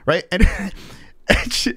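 plain reading voice

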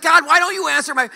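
high, loud, indignant complaint; exasperated at God. Retold or imitated. Pastor complaining to God about no police, loud indignant plea. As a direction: throw it up at heaven, aggrieved. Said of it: "I'm like".

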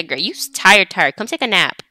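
ordinary talk, nothing marked

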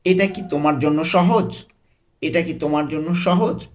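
"সহজ" lifted as a clear question; questioning tune. Shown as a demonstration. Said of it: "question করছি".

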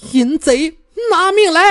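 high, loud furious cry; fierce scolding shout. A narrator's reading. Fairy Mengluo charging Bai Jianfei, high furious shout. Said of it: "指着白剑飞娇斥".

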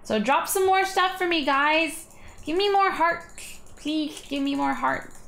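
high nasal nerd voice. Pretend. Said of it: "He's laughing at this nerd voice".

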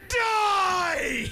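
high shouted battle cry. Real, not acted. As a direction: yell it at the enemy. Shouting at enemy.

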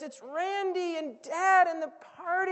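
high, loud and urgent; insistent servant. Acted. Servant urging the older brother to come in.

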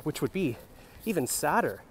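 normal talking voice

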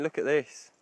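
usual speaking voice

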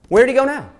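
high, loud searching call; excited calling. Acted. Searching for robot.

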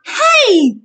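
high, loud protest. A narrator's reading. Annabeth pushed onto the bed, protesting loudly. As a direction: cry out in indignant protest. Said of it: "she protested".

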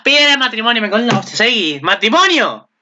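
high, disbelieving squeal; thrilled outburst. Real, not acted. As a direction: gasp it out, overjoyed. Proposal scene reaction.

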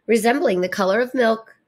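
plain reading voice

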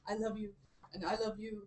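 very soft, hushed love words; tender lovers. Retold or imitated. Retelling two lovers finally alone, tender and hushed.

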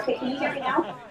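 tinny cell phone speakerphone; like talking through a phone on speaker. Real, not acted. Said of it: "on speakerphone on a cell phone".

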